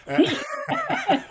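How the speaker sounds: high, loud laughing burst; burst of laughter. Real, not acted. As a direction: break into real laughter. Laughs at t-shirt idea.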